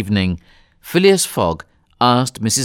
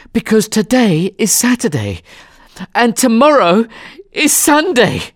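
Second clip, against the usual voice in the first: high, breathless, emphatic outburst; excited; 12 semitones higher, 4 dB louder than usual. A narrator's reading. Passepartout explaining the date to Fogg, excited. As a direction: burst out with it between breaths. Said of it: "he said excitedly".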